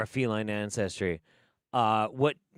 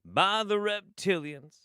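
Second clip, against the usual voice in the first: high, loud pastor drawl, slowing into a sermon; mock the coach as a Baptist preacher; evangelical preacher; 10 semitones higher, 5 dB louder than usual. Shown as a demonstration. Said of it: "a slight drawl in which we're talking".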